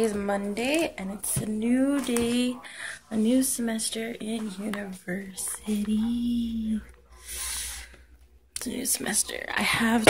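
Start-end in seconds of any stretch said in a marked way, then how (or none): 3.1-9.4 whispering. Real, not acted. Quiet morning talk.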